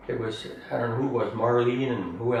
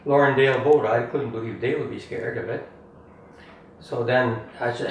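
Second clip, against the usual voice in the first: ordinary talk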